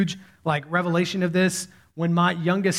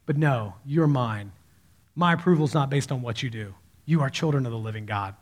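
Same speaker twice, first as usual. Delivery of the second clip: reassuring God; 5 semitones lower than usual. Acted. God reassures after remembering his promise.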